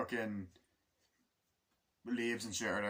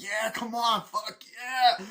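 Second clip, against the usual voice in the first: much higher, louder hammed-up whoop; soldier cheer; 12 semitones higher, 8 dB louder, much slower than usual. Soldiers cheering during the battle, mock whoop. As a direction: cheer like a soldier after a hit.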